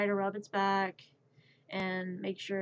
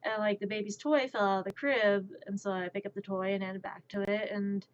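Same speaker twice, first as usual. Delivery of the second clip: ordinary talk, nothing marked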